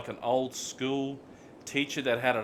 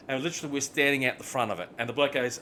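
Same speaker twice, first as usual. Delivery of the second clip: normal talking voice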